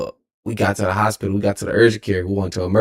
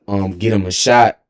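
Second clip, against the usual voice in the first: ordinary talk, nothing marked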